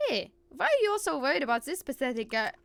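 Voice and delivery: put-on dismissive German-accented complaint. Villain ignored as others help Kaito, German accent. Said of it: "yell all that shit out with a German accent".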